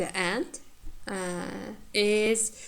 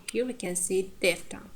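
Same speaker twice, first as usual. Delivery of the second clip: usual speaking voice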